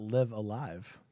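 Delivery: "Live Alive" with short i in Live, long i in Alive; suggested pronunciation. Shown as a demonstration. Co-host suggesting how the title reads, "Live Alive" as liv uh-LYVE. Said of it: "You live when you're alive".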